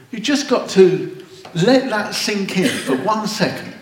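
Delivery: laughing. Real, not acted. Preacher laughing, urging reflection.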